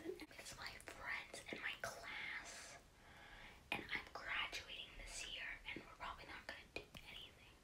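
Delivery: sad hushed whisper. Real, not acted. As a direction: whisper it, missing friends. Whispered sad news update.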